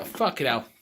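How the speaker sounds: ordinary talk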